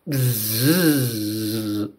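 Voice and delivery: long low humming buzz. Acted. Dragonfly lifting off with Lila, low buzzing. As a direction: hum like wings beating.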